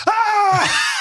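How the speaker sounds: sudden high loud shriek of fright; startled dad screaming at the wheel; retold scream. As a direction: yelp like a cold hand just touched your arm. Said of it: "I screamed".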